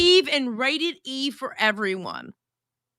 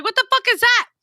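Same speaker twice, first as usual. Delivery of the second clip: higher and louder, a mock shocked outburst; shocked question; 9 semitones higher, 5 dB louder, faster than usual. Streamer retelling first seeing a countertop dishwasher, mock shocked. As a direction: blurt it like you can't believe it. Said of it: "I'm like".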